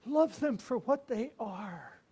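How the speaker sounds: slow, earnest, pressing; slow tender counsel. Retold or imitated. Old priest counselling the young one, slow and tender. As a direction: lay it on him slowly and kindly.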